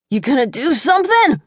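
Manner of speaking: high, strained squeak. A narrator's reading. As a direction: squeeze the words out mid-fight. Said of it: "he squeaked out".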